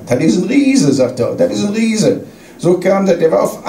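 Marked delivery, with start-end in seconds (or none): 0.1-0.9 retold rave about a player, pitch lifted; mildly excited quoted praise; imitated enthusiasm. Acted quote of enthusiastic coach. 1.3-2.2 retold marvelling, lifted voice; imitated enthusiasm. Acted quote of enthusiastic coach.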